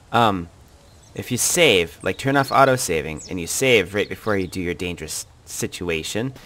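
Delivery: normal talking voice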